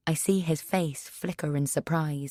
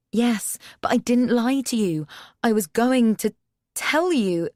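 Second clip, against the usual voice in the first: higher and louder, insisting; raised defense; 7 semitones higher, 5 dB louder than usual. A narrator's reading. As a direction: plead loudly to be believed. Cass defending herself loudly.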